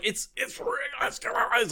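mock choking, high and strangled; high strained gasps, acting strangled. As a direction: choke the words out quietly. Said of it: "like, like, choking on it".

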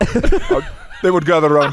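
usual speaking voice

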